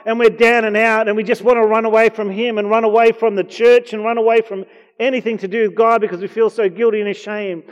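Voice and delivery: loud, high, impassioned sermon voice; fervent preaching. Acted. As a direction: preach it out to the whole room. Preacher at full force.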